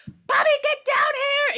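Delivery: high, loud, drawn-out yell; full-voiced angry summons; mother yelling. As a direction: yell for a child upstairs. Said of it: "So she's yelling".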